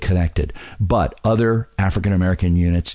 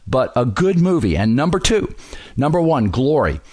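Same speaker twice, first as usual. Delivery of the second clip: ordinary talk, nothing marked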